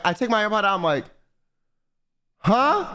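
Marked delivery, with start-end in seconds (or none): 2.4-2.9 baffled huh. Retold or imitated. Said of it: "I literally look at her like".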